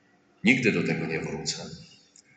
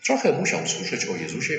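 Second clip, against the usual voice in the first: ordinary delivery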